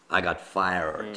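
put-on low, slow American accent. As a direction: say it slowly as an American. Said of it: "would be in America".

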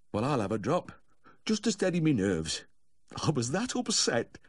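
high Yorkshire character voice, slowing; still-upset owner. A narrator's reading. Owner excusing a drink to the vet, still upset.

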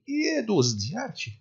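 یه rising high on یه دزدی; stressed indefinite. Shown as a demonstration. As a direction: lean on یه to mean any thief at all. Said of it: "تاکید داره روش". (تاکید روی «یه» با صدای بالا)

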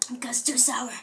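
soft whisper through candy. Real, not acted. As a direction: breathe it out unvoiced. Whispered while sucking candy.

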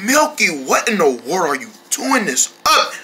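ordinary talk

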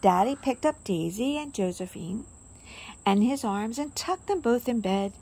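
usual speaking voice

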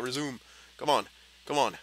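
ordinary talk, nothing marked

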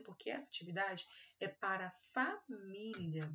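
ordinary delivery

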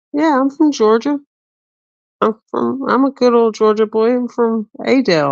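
put-on high-pitched good old boy Southern accent. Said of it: "bust out my Southern accent".

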